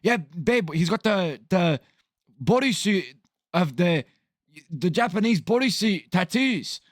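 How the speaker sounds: halting, hammed-up fake Irish voice; muddled accent. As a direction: put on a bad, exaggerated Irish accent. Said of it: "I don't know what l-, accent we're doing here".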